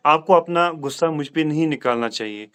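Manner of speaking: usual speaking voice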